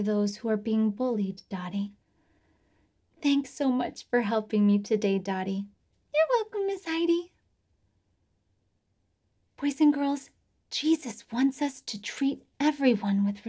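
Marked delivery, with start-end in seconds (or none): 6.1-7.3 high squeaky puppet voice. Acted. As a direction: pitch way up like a child puppet. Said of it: "my friend Dottie".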